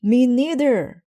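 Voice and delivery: put-on high, loud boy's yell. A narrator's reading. Brother yelling down to Mama from upstairs. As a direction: holler it from upstairs. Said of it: "yelled Brother".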